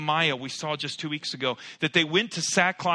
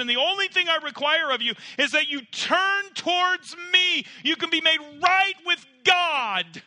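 much higher and louder, pleading preacher; impassioned altar call; 14 semitones higher, 6 dB louder than usual. Acted. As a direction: cry out the call to turn.

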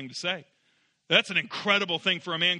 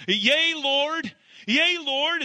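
crying out, pitched higher; desperate plea; 8 semitones higher, 3 dB louder, much slower than usual. Shown as a demonstration. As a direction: beg the Lord, voice lifted high. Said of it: "as he would cry out".

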